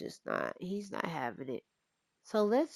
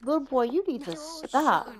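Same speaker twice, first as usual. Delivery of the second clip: higher, louder put-on scolding voice; bossy big sister; 8 semitones higher, 9 dB louder than usual. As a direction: tell the boy off like a sassy older sister. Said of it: "She's like".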